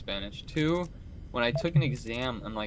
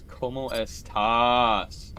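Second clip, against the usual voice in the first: very slow, drawn-out, louder meme punchline; screaming turtle meme; 7 dB louder, much slower than usual. Retold or imitated. Recalling a video's punchline, imitated screaming turtle. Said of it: "The turtle screaming".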